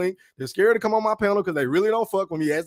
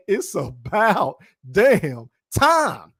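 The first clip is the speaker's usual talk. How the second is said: very slow, long pauses between "about", "damn" and "time"; dramatic emphasis; 4 dB louder, much slower than usual. Real, not acted. YouTuber reveals the top poll answer, slow and dramatic. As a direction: draw out "It's about damn time" word by word, punching "time".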